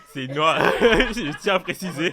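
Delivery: loud, high laughter breaking through the words; cracking up at her own joke; laughing. Real, not acted. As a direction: laugh hard while making the point. Laughing joke about her mother.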